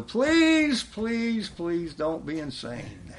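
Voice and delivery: urgent plea to come to Jesus; pleading. Real, not acted. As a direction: beg them, voice rising on please. Pleading with listeners.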